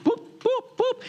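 high, popping boops; little high-pitched tool noise on each pick; imitated boop. Shown as a demonstration. Showing how the pecan picker-upper works, playful boops.

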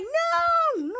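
high, squealing "no, no" in sudden dismay; dismayed cry. Retold or imitated. Kid seeing the wrong channel, high dismayed no. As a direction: yelp like the show just got stolen. Bad news interrupts the show.

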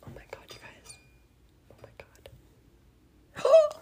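shocked whisper. Real, not acted. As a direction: breathe it out in disbelief. Just burned her desk.

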